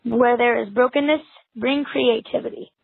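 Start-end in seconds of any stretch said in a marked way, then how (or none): none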